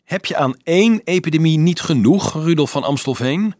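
high, loud teasing question; scoffing, exaggerated incredulity; mocking. A narrator's reading. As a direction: taunt him with it. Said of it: "keek hem spottend aan".

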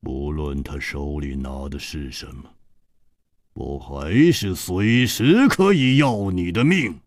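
低沉冰冷，一字一顿; 慢慢咬字，狠狠威胁; 一字一字地说. A narrator's reading. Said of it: "一字字地说". (黑袍客一字一顿地威胁楚留香，低沉缓慢)

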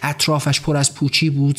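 ordinary delivery

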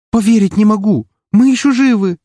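very high, loud overwrought shout; high-pitched, emotional exclamation; trembling cry out. Acted. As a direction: cry out in shaken disbelief at surviving. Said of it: "выкрикнула Рокси дрожащим голосом".

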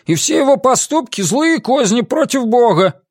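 high-pitched, emphatic denunciation; accusing villager. A narrator's reading. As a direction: denounce him before the crowd. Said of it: "повторил кто-то из жителей деревни".

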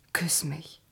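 soft unvoiced whisper, intimate. Making up with Odysseus, she whispers for a kiss. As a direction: breathe it close to his ear. Reconciling, she asks softly.

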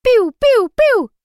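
loud, very high falling "pew" zaps; rapid-fire squealed blaster sounds; electronic laser shots. A narrator's reading. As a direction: fire off toy laser noises. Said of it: "издав очередь электронных залпов".

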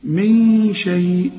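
من شيء very slow, long hidden n hummed before sh; nasal hold. Shown as a demonstration. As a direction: stretch the hum in من before شيء. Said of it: "عاوزين غنة هنا".

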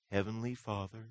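mock pious prayer voice. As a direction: lightly imitate an overly pious prayer. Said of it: "why the weird voice?".

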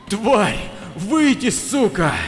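high, angry shout at the monster; furious yell. Acted. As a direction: scream the challenge at full force. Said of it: "кричал я, что есть силы". (яростный крик)

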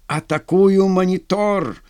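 high, drawn-out battle cry; shouted. A narrator's reading. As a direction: shout the attack, slowly and high. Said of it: "крикнул лейтенант". (крикнуть протяжно, боевито)

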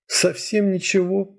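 put-on drawn-out girl's question, smiling through tears. A narrator's reading. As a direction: ask gently through a teary smile. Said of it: "сквозь слёзы улыбнулась она мне".

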